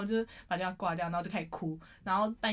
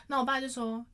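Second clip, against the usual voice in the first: normal talking voice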